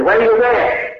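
high-pitched mock demand; demanding father. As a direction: imitate a father confronting his daughter. Angry father confronts daughter in story.

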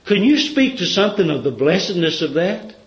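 usual speaking voice

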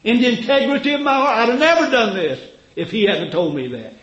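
high, loud pleading protest; upset Abimelech. Acted. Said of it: "Abimelech got all upset and he said".

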